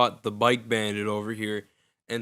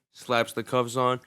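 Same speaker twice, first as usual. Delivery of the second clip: normal talking voice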